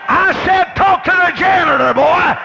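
high, loud pulpit shout; full-force preaching. Real, not acted. Preacher ordering them to talk to the janitor, shouting. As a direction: shout it as an insistent, repeated order.

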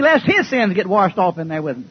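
ordinary talk

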